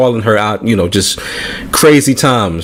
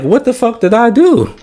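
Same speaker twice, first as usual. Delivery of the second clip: higher, baffled outburst; exasperated disbelief; 10 semitones higher than usual. Retold or imitated. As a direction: throw up your hands in disbelief. Retelling his baffled reaction.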